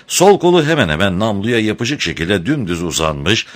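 plain reading voice

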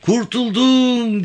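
very high, long drawn-out cry of relief; slow, soaring yell of deliverance; jubilant shout. A narrator's reading. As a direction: shout it to the skies, stretching the last vowel. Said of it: "diye kollarını kaldırarak bağırmaya".

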